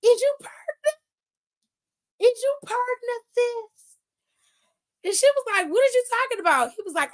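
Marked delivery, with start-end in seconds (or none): 0.1-4.0 high-pitched scandalized question; mock shock. Host reacting to messy wrestling gossip, mock shocked. 5.7-6.7 mock bewilderment. Acting out her startled denial.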